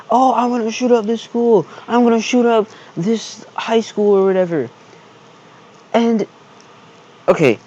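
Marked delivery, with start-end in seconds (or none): 0.1-4.7 mocking the shooter. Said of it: "out in public saying".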